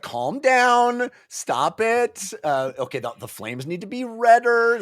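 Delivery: coaching Bottle. Retold or imitated. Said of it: "Bottle's just like".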